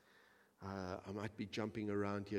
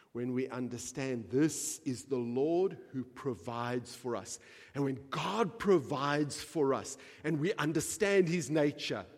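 rising, louder preacher's climax; preaching at full force; 4 semitones higher, 8 dB louder than usual. Acted. Preacher lifting the congregation, preaching at full force. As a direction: build it up like a pulpit crescendo.

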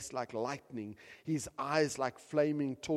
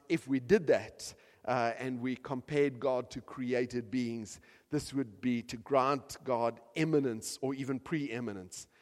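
usual speaking voice

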